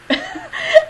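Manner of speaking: a sudden, high, loud laugh; burst of high laughter. Real, not acted. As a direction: laugh out loud, caught off guard. Friends say they always knew.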